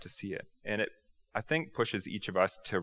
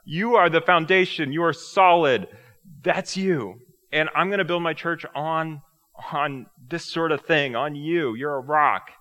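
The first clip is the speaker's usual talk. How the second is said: loud, higher, fired-up hype with a laugh; pumped-up Rocky pep talk; 7 semitones higher, 10 dB louder, slower than usual. Retold or imitated. Jesus hyping Peter up as Rocky, loud and fired up. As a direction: cheer Peter on like a boxing coach. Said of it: "He's, he's Rocky Balboa".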